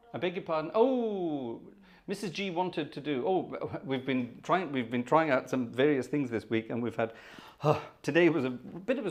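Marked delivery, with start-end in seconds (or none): none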